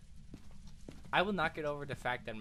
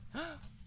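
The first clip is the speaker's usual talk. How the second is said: high sharp breathy gasp; excited gasp; 13 semitones higher, 7 dB quieter than usual. Real, not acted. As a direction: gasp at the treasure. Spotting valuable loot.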